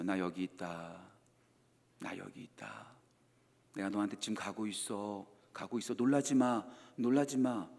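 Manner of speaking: very soft, low, hushed reassurance; quiet gentle call. Retold or imitated. God softly announcing himself to hiding Adam and Eve, very quiet. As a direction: barely voice it, gently making yourself known. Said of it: "조용히 자신의 인기척을 드러내세요".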